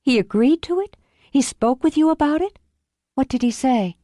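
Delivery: lightly voiced Olivia, shaken; stunned disbelief. A narrator's reading.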